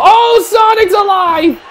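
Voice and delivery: high-pitched, exclaiming commentary; excited surprise. Real, not acted. Commentator seeing Sonic survive, excited high-pitched surprise. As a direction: shoot up in pitch at the comeback.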